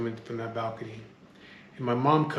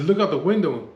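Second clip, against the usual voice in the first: louder, urgent order through the door; raised retold order; 4 semitones higher, 6 dB louder than usual. Man yelling at his cousin behind a locked door, loud. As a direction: push him to look outside.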